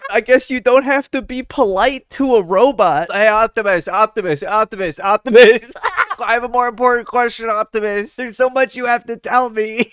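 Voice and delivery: loud, high calling out; mock shouting. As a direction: yell for the robot's attention. Mocks shouting at robot.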